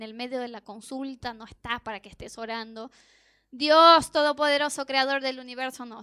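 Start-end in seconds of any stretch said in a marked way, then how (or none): 3.5-5.8 loud, booming showy invocation; mock grand prayer. Preacher showing the prayer she avoided in a clinic, grandiose and loud. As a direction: open a prayer far too grandly and loudly.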